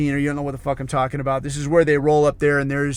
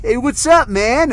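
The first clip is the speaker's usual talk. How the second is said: higher, louder mock hail to an old buddy; upbeat greeting; 8 semitones higher, 4 dB louder than usual. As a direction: call out a retold greeting. Acts out old acquaintance's casual greeting.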